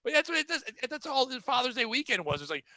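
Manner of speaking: ordinary delivery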